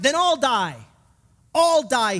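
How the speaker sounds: a preacher's restrained version of a furious shout; mock shout. Guard threatening the prisoners with death, mock shout. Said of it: "the guard began to shout".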